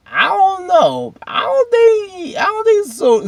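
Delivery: very high, slow squeaky hedging; drawn-out doubt. Acted. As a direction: stretch it out way up high. Mock doubt to Isaiah.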